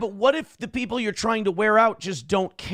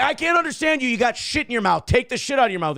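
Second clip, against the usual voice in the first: mock yell; 7 semitones higher than usual. Mocking the caller's mumbling, mock yell. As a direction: yell it to humiliate him. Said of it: "he definitely got yelled at".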